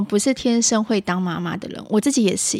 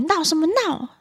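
high-pitched exasperated scolding; irritable mother; 11 semitones higher than usual. Acted. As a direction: act a mum fed up with a tantrum. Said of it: "暴躁的妈妈会说". (暴躁妈妈尖声数落)

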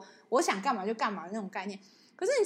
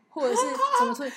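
laughing; 13 semitones higher, 7 dB louder, faster than usual. Real, not acted. Laughing at her own dilemma.

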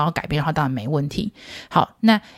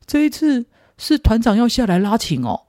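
teasing announcement; 6 semitones higher than usual. Retold or imitated. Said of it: "keyboard先生就跑出来说".

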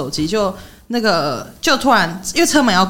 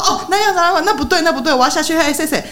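high, hurried auntie voice; fluster, then rush off the train; 7 semitones higher than usual. Retold or imitated. Auntie realizing she took the wrong train, flustered.

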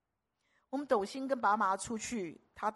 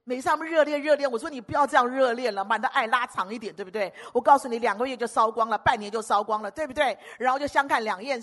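louder, driving sermon voice; fiery preaching; 2 semitones higher, 10 dB louder than usual. Acted. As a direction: hammer the warning home. Preaching at full force. (激昂讲道)

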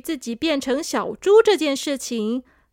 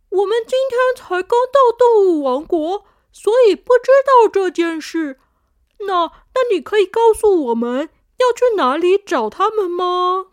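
put-on higher and louder, an eager young piglet; little pig voice; 6 semitones higher, 8 dB louder than usual. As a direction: explain and ask like a small visitor piglet. Little pig explains, asks way.

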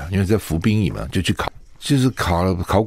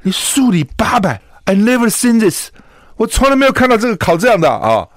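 amazed American dean, pitched way up; astonished dean; 13 semitones higher, 4 dB louder than usual. Retold or imitated. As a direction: play the dean who can't believe the score. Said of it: "然后他就一看说".

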